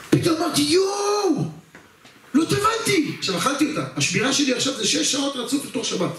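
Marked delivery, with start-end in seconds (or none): 0.1-0.6 raised exclaim. Real, not acted. Sudden panic over missed immersion. 0.6-3.1 much higher, panicked yell; panicked cry. Retold or imitated. Soldier on guard realizing he forgot to immerse, panicked yell.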